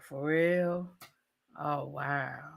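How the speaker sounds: a soft, far-off mock of his flat reaction; flat, unimpressed reaction. Boyfriend hears she is pregnant, says it with an unimpressed mumble. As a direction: shrug it off like the news barely landed.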